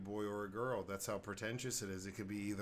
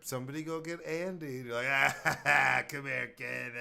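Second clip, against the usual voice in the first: gleeful daycare worker; 6 semitones higher, 8 dB louder than usual. Pretend. Daycare worker cheerfully calls for a crying kid. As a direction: call out for a crier with a laugh. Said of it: "worst daycare worker ever".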